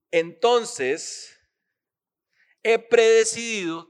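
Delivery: loud, emphatic preaching. Acted. Pastor urging the congregation to plan ahead, preaching loud. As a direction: drive the point home to the church.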